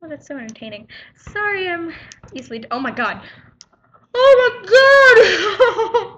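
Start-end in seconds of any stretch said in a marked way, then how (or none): 4.1-6.2 startled shriek into laughter; jump scare laugh. Real, not acted. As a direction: scream then laugh, startled. Scared by Bobby in background.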